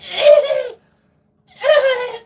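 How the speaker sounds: very high, loud forced squeal; hammed-up high wailing; strained mock wail. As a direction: squeal as if forcing down dreaded food. Strains to act pleased while eating.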